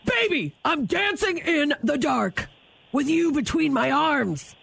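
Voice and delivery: high, barking soldier lyrics; drill sergeant voice. Acted. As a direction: bark song lyrics like orders. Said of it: "give me one more lyric".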